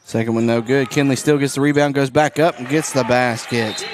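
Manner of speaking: play-by-play. Acted. Announcer calling the late fourth quarter, livelier play-by-play. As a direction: ride the action up.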